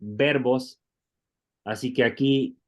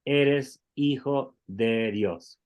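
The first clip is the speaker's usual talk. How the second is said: eres hijo de Dios read slowly, word by word; careful, drawn-out classroom reading; slow reading aloud; much slower than usual. Shown as a demonstration. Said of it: "y ahora leemos al final".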